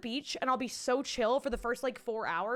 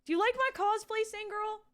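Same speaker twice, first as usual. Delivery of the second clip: playful high voice; 9 semitones higher than usual. Pretend. Addressing a viewer playfully.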